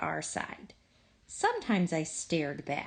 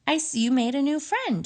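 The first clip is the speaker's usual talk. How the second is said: bright teasing mama; 7 semitones higher, 5 dB louder, faster than usual. Acted. Mama speaks, pleased.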